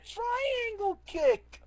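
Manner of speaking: very high, slow put-on battle cry; mock attack cry. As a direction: squeal it like a silly fighting move. Repeats the attack cry mockingly.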